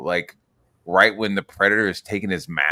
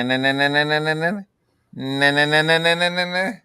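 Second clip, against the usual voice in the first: higher, rising rapid nah-nah gun noises; spray the gun noises faster and higher; imitated gunfire; 7 semitones higher, 3 dB louder than usual. Describing Murphy's shooting to a friend with gun noises.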